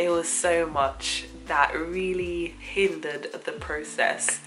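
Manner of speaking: ordinary talk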